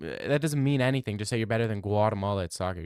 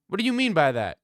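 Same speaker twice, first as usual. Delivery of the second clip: higher, louder "what do you mean by that"; taken aback; 7 semitones higher, 4 dB louder than usual. Real, not acted. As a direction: caught off guard, push back. Reacting to a chat question.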